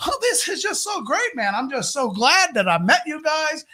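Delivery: high, delighted chatter; butter them up eagerly; hammed-up overfriendly tourist; gushing stranger voice. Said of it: "tell his voice in a way of how he made me feel".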